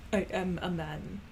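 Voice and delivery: slow, lightly voiced accented character; attempted character voice. Trying to get Ivanushka's voice down, slow tentative character voice. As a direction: try the Russian character, slowly and deliberately. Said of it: "I have to get the voice down".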